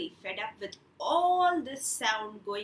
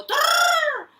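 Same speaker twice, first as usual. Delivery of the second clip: high loud trumpeting call; elephant trumpet; 13 dB louder than usual. Retold or imitated. Storyteller imitating elephant for kids, loud trumpet. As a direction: blast it out like an elephant. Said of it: "The elephant will go".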